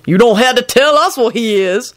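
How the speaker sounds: high, disdainful retort; sniffy. A narrator's reading. Lucretia scoffing about the man, sniffy. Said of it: "Lucretia sniffed".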